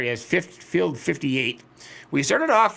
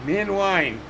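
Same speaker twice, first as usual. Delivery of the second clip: higher and louder, Min wine sung out; playful wordplay; 6 semitones higher, 4 dB louder than usual. Shown as a demonstration. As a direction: punch Min wine as a joke. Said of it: "repeated with more force".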